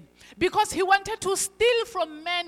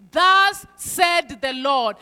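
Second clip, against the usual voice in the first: loud, slow and proclaiming; preacher's ringing proclamation; bold declaration; 11 dB louder, much slower than usual. Acted. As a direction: plant each word like a banner. Congregation declaring God's word.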